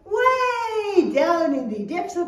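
slow teacher-led recitation, phrase by phrase; saying it together. Shown as a demonstration. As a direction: lead the children slowly through the verse. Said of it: "Let's say it together".

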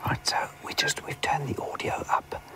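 whispered commentary, barely voiced; hushed whisper. Real, not acted. Guide to viewers in case the bird calls, hushed whisper. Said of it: "talk quietly like this".

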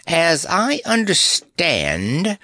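high, slow croak; lightly croaked bird voice. A narrator's reading. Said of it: "croaked the bird".

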